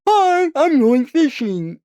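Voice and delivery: high, loud, slow cartoon cat voice; mock Big the Cat. Site's Big the Cat greeting users, in a high, loud, slow cartoon voice. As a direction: chirp it as a goofy cartoon cat.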